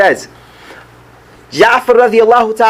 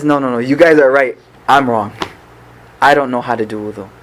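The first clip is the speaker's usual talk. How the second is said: lightly voiced uncle, lower and subdued; humble concession; 7 semitones lower than usual. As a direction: concede gently, pitch dropping low. Old uncle humbly admits error.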